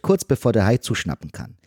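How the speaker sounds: ordinary talk, nothing marked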